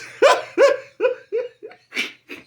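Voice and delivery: shrieking laugh; high loud cackle. Real, not acted. Big laugh.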